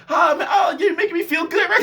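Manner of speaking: very high, loud hammed-up joy; mock delight. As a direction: overplay feeling good. Mock feel-good gushing.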